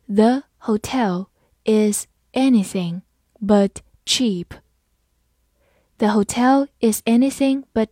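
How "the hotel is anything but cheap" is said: slow, careful word by word; slow reading. Shown as a demonstration. Teacher modelling for students, slow and careful. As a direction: read it out slowly and clearly. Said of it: "好，慢慢来读。".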